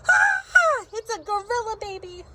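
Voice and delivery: high startled shout; yelled. Acted. Parents grabbed by the ear, yelling in shock. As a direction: scream out in shock. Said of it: "they both yelled".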